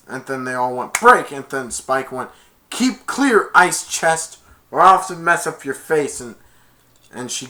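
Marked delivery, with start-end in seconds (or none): 0.9-1.3 loud, high team call; huddle shout. Retold or imitated. Team breaking the huddle, loud call. 2.7-6.2 raised, loud threatening voice; threat. Retold or imitated. Spike threatening Becky, raised threatening voice.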